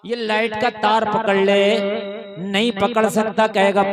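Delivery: loud preacher's exhortation; loud sermon cry; fiery preaching. Acted. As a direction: thunder it to the crowd. Preacher at full force.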